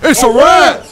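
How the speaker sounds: startled yelp. Real, not acted. Sudden rat attack in game.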